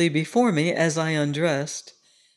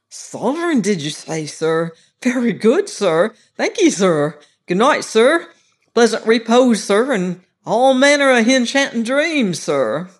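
higher and louder, bowing and scraping; delighted steward; 5 semitones higher, 6 dB louder than usual. Acted. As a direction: gush thanks at the tip.

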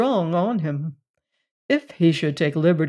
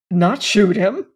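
incredulous, slightly louder question; astonished cry; 2 semitones higher, 4 dB louder than usual. A narrator's reading. Randolph questioning the woman, astonished cry. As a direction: cry it out in disbelief. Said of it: "cried Randolph".

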